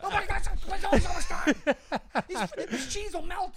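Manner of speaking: high, loud laughing panic; cracking up while faking urgency; laughing mock alarm over cheese. Real, not acted.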